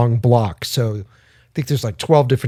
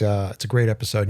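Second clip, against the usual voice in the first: usual speaking voice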